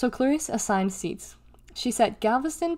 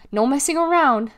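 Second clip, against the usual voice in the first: loud, higher, firm warning; ordering; 5 semitones higher, 7 dB louder than usual. A narrator's reading. Deli warns R B, ordering loudly. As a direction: lay down another rule forcefully. Sister orders her brother.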